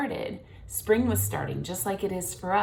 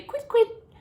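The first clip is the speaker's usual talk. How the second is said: high, louder chirpy bird call; partridge call; 5 dB louder than usual. A narrator's reading. Father swan imitating the partridge, high chirps.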